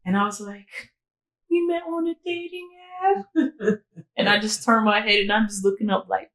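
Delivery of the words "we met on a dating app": much higher, sheepish put-on voice; awkward sheepish answer. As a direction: squeak it out, embarrassed. Awkward answer to the grieving mother.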